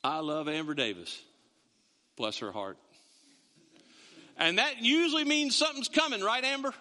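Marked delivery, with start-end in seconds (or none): 0.0-2.8 low, soft sugary put-down; sweet on top, a dig underneath; mock southern gossip. Preacher fakes gossiping about Amber, low and soft. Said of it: "The southern way to do that is".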